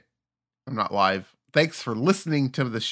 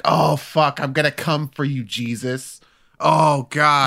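mock orgasm moan; 4 semitones higher, 4 dB louder than usual. Mock religious sex moan.

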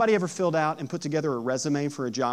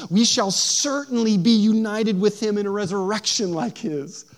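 scripture reading; 4 semitones higher, 6 dB louder than usual. Acted. Pastor reading Romans to the congregation, loud and proclaiming. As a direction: proclaim the passage like a preacher in full flow. Reads Paul's letter to congregation.

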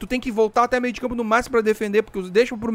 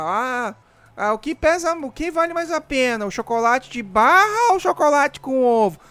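higher, ditzy mocking tone; mock silly voice; 4 semitones higher, slower than usual. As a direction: ask it like a clueless complainer. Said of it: "Não aguento aqueles caras que já começam".

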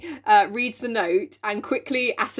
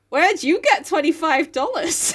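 mock astonished; 7 semitones higher, slower than usual. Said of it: "everyone's like".

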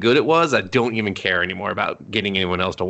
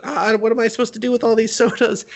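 put-on high, flustered boy voice; high mock panic over the sodas; 12 semitones higher than usual. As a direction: play the overwhelmed boy juggling sodas. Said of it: "He's like".

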